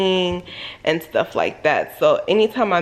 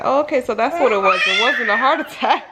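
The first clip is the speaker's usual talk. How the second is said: relieved laugh; 4 semitones higher, faster than usual. Retold or imitated. Relief discovering it was panic.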